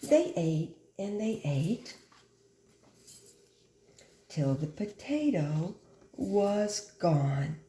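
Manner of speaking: slow, drawn-out closing lines; slow story ending. A narrator's reading. Teacher reading the ending to children, slow. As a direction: let the story wind down gently.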